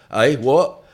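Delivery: loud, high mock puzzlement; loud uncomprehending query. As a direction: imitate someone who hasn't got a clue. Mimics someone who fails to understand.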